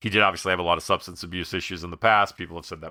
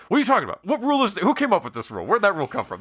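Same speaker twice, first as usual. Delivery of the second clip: high, pitched-up manic protest; manic outburst; 13 semitones higher, much faster than usual. Retold or imitated. Richard Lewis protesting an etiquette rule, in a high manic rant. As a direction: rant in rising disbelief, voice jumping high. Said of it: "that manic sense of".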